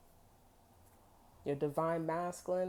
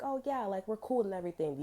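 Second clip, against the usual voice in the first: higher-pitched, chipper buddy act; 5 semitones higher than usual. Acted. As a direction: play the friendly, easygoing guy. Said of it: "authentic and chipper and be like".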